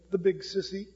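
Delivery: softly imitated quivering child; small, soft voice of an upset boy; little quivering voice. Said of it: "in a little quivering voice".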